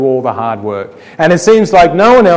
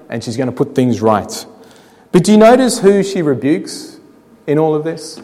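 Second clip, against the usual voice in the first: ordinary talk, nothing marked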